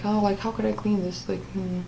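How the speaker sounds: soft, hushed inner voice fading to whisper; quiet thinking aloud. Retold or imitated. Recalling herself wondering about the broken cup, hushed thinking. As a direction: think it to yourself, trailing into a whisper. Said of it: "I was, like, thinking".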